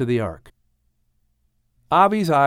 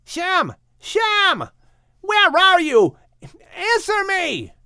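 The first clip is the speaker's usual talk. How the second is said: high, frantic calling out; shouting for Shem; slower than usual. Acted. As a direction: yell for your brother across town. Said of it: "Ham shouted".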